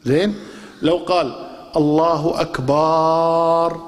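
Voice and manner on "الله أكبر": الله أكبر very slow with an overlong stretch; overstretched الله أكبر; wrong long madd. Shown as a demonstration. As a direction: drag the vowels far too long. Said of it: "ما تصح".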